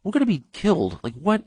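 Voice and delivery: spooked like in a Stephen King story; mock panic. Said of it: "I'm like".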